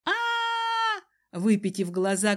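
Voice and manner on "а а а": long, very high scream; sustained high shriek of fear; terrified scream. A narrator's reading. Kiran screams in terror while dangling in the air. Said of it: "кричал Киран".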